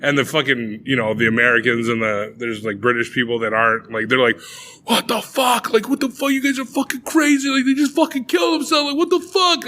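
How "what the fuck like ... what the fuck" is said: high-pitched imitation of freaked-out outsiders; mock horrified outburst. Shocked outsiders reacting to the cliff ritual, mock horrified outburst. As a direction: act out appalled disbelief, pitched way up.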